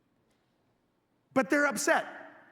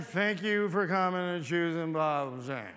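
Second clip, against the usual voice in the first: bored mumble; 6 semitones lower than usual. Retold or imitated. Mocks the bored clerk's greeting.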